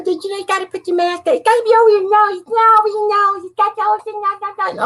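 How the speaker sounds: high nagging voice; high mocking shopper nagging about masks; mock mask nag. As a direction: nag like a fussy mask enforcer. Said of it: "they say".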